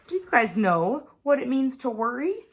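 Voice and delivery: ordinary delivery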